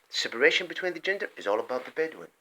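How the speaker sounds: ordinary delivery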